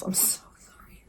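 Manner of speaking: barely audible whisper; whispered apology. Real, not acted. Vlogger apologizing for unrelatable problems, whispered. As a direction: whisper it sheepishly.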